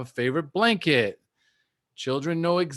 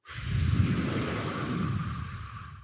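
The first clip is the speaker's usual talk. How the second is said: calming sigh; 5 dB quieter than usual. Shown as a demonstration. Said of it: "So I'll be like".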